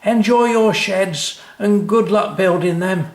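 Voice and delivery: high, loud breezy workman; cheery lorry driver. A narrator's reading. Lorry driver waving Dad off, high and breezy. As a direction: toss it off to the customer. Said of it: "said the lorry driver".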